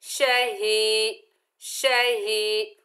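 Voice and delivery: drawn-out recited शही with a nasal ending; slow Quranic recitation. Shown as a demonstration. Teacher reciting शही slowly for the student. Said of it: "कॉफ के ऊपर दो जबर की तनवीन है".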